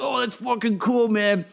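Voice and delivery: a friendly stoned guy on the street, unbothered; laid-back junkie. Retold or imitated. Junkie voiced in story.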